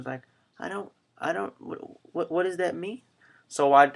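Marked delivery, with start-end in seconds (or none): 0.6-3.0 put-on high, soft, stammering child; confused young daughter. Daughter not understanding Daddy's instructions, imitated confused child. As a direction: imitate a little girl who is lost. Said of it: "I told my daughter".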